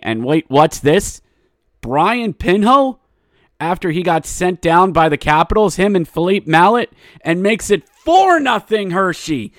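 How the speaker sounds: excited high, loud goal call; hockey play-by-play. Real, not acted.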